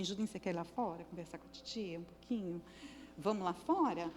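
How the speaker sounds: put-on soft, hushed coaxing of a child; hushed, gentle invitation to step outside. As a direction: coax him out quietly and kindly. Helper coaxing the unruly boy.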